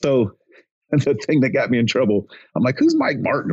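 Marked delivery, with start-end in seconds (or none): 2.8-3.5 retold bafflement. Retold bafflement at unknown coach's name.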